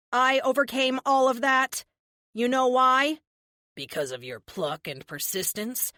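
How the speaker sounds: defiant speech. Acted. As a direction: declare it in triumph. Heroine's triumphant speech.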